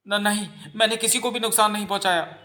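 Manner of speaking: high, stammering plea of innocence; frightened denial. Acted. Accused girl answering the stone-throwing villagers, frightened denial.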